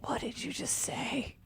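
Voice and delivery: hushed, breathy whisper of confusion; barely voiced, lost in the accent. Reporter to a Southern player, confused whisper. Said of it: "and I'm like".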